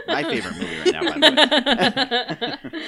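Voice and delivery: high squealing laugh through words. Real, not acted. Laughing about favorite movie.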